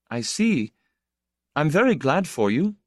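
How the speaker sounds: plain reading voice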